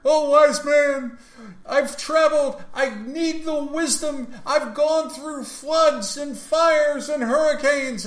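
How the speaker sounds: high, loud, slow pleading; hammed-up desperate seeker begging for wisdom; pleading pilgrim. Seeker begs the wise man on the mountain, high and loud.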